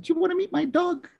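put-on high sweet old-lady voice; imitating a kindly old lady, very high; Missus Doubtfire. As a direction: coax a child in a falsetto granny voice. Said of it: "sweet old lady".

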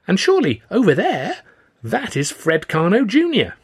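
put-on high, excited young admirer; eager youth. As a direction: gush like a starstruck lad, pitch up. Eager youth spots famous men.

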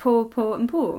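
"poor" each time as PAW, no R; all pronounced the same. Shown as a demonstration. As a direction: say every "poor" identically, R dropped. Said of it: "those are all exactly the same".

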